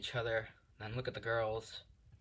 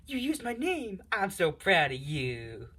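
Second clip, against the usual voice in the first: much higher, then louder and slow, overjoyed; thrilled squeal; 8 semitones higher, 7 dB louder, slower than usual. Acted. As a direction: gush with delight. Uraraka thrilled at her name.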